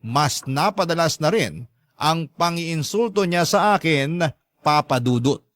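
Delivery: ordinary delivery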